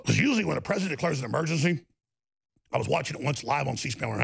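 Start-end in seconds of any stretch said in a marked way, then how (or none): none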